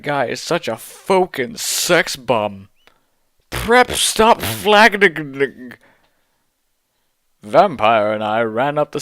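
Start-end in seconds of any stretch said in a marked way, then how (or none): 3.5-5.7 higher, slow, hammed-up sneer; mock prep voice. Author's note jab at the preps, slow and mocking.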